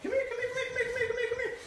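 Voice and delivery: high puppy call. Real, not acted. Trainer coaxing the puppy over, high sing-song call.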